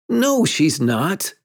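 put-on slow, high boyish contradiction; denial. A narrator's reading. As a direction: refuse it, drawing out the words. Boy refuses to believe her.

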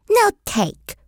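put-on high little-kid voice, babyish; toddler voice. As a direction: play a stubborn toddler refusing. Toddler Fudge answers mother.

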